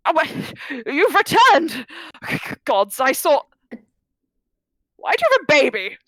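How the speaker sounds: put-on high, loud, quavering old man; frail old man, startled. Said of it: "exhausted-looking old man, Corvius".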